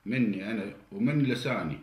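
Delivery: ordinary delivery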